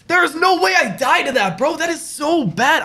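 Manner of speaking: high, stretched complaint; slow, high whine of disbelief. Real, not acted. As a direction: refuse to accept the death. Disbelief at dying.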